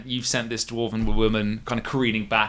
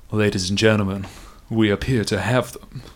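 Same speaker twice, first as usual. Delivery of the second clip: a light Irish brogue, smug and unhurried; lightly voiced Irish brogue, savouring the trap; Jarnell's brogue; 2 semitones lower than usual. The captain gloating at the trapped party, in a light Irish brogue. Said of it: "the unmistakable brogue of, of Captain Jarnell saying".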